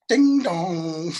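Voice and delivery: sing-song ding dong of a doorbell; imitated doorbell. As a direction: mimic the chime at the door.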